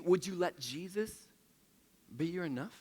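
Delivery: ordinary talk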